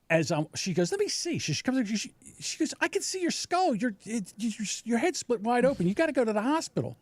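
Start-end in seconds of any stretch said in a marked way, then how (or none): 0.9-1.4 high-pitched imitation of a neighbor; concerned neighbor. Neighbor asking to inspect his bleeding head, high-voiced concern. 2.8-7.0 high, urgent imitation of a woman; alarmed neighbor. Neighbor spotting the boy's open wound, high alarmed urging.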